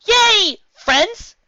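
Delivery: high, loud, delighted squeal; excited squeal. Acted. Lyra squealing with joy after the hug. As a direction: squeal it with glee. Lyra cheerfully hoping for friendship.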